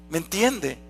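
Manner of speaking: ordinary talk, nothing marked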